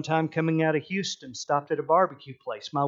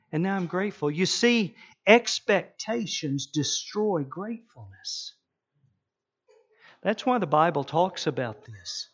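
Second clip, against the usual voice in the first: ordinary talk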